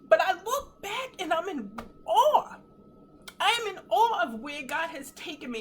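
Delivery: real tears, voice lifting high and loud; tearful awe. Real, not acted. Testifying where God has taken her, tearful awe. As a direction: overcome with awe, crying as you testify. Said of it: "I'm sorry for the tears".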